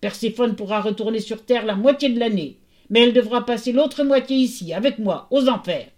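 loud, grudging ruling; forceful, reluctant Hades; grumbling. A narrator's reading. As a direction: lay down terms, insisting. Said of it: "grommela Hadès".

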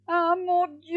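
high, loud theatrical lament; wailing Dame Hérisson. A narrator's reading. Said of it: "se mit à gémir Dame Hérisson". (gémissement aigu et théâtral)

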